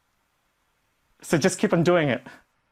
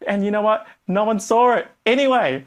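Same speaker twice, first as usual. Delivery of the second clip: normal talking voice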